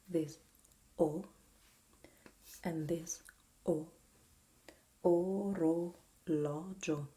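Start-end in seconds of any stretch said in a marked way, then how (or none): 5.0-7.1 orologio very slow and loud, "oh... roh... LAW... jo"; syllable demo. Shown as a demonstration. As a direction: stretch orologio syllable by syllable, opening the third O. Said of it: "Can you hear it?".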